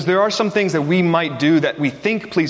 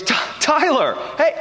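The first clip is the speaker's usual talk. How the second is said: high-pitched, delighted hello; excited greeting; 12 semitones higher, slower than usual. Retold or imitated. Doctor spotting his patient in the lobby, excited greeting. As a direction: imitate someone thrilled to spot a friend.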